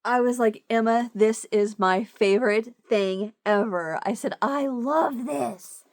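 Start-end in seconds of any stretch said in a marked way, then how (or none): 0.7-4.0 loud, slow retold gushing; emphatic gushing. Retelling her thanks to Emma, emphatic gushing. 4.4-5.8 loud, long-drawn adoring exclamation; adoring gush. Retold or imitated. Telling Emma she loves the gift, adoring gush. Retells gushing thanks for gift.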